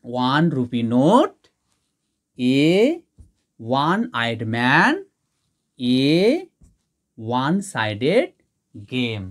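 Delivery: teacher slowly reading out the finished examples. Shown as a demonstration. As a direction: dictate the examples slowly for the class. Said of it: "তাহলে আমরা এ বসিয়ে দিই".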